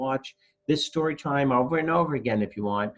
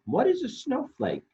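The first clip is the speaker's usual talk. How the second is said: much higher, curious little voice; Lumpy; 13 semitones higher than usual. Acted. Storyteller voicing Lumpy, high and curious. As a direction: ask it up high like a small child. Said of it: "asks Lumpy".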